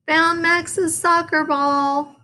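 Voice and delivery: high slow called-out find; yell. A narrator's reading. Froggy yelling his find to everyone. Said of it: "yelled Froggy".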